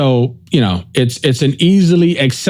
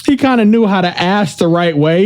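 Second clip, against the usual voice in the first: normal talking voice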